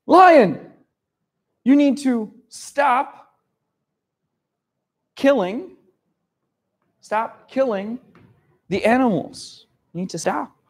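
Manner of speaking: a hint of pleading animal kings; kings demanding. Animal kings telling the lion to stop killing, urgent. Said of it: "and they say".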